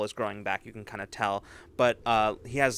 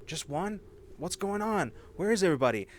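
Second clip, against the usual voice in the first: anxious crowd voices; 8 semitones higher than usual. Acted.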